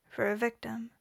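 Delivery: plain reading voice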